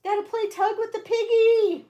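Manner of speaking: high cutesy voice for the dog; host voicing her pit bull in a high baby voice. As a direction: speak for the dog, sing-song. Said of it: "She's saying".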